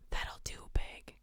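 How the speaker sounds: hushed creepy whisper. Pretend. Streamer reading a creepy chat line, whispered. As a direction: whisper it like a secret. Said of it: "A S M R for the horrible".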